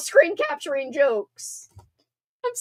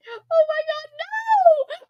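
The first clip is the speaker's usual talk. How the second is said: high squealing oh my god; shocked squeal; slower than usual. Real, not acted. Streamer squealing at a chat joke, shocked. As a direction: shriek in mock horror at chat.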